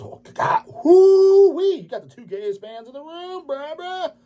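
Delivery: hammed-up giddy shriek mocking the band picks; mock excitement. Mocking a fan's double order of Korn and Slipknot, squealing high.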